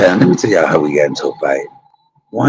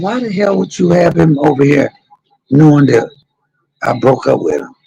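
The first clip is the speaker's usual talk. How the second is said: high, indignant mock outrage at mama; angry demand; 10 semitones higher than usual. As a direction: demand an answer, pitched way up.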